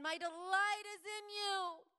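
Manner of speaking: high, loud, ringing call; God calling lovingly to his people; calling from heaven. Acted.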